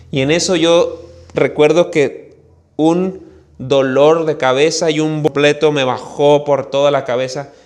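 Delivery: usual speaking voice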